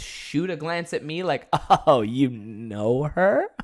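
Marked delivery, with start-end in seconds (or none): 1.9-3.5 slow, low judgmental question, rising at the end; pure judgment. Retold or imitated. Bar owners shoot a glance at their host, slow and judgmental. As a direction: imitate the bosses' drawn-out disapproval. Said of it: "Judgment all the way".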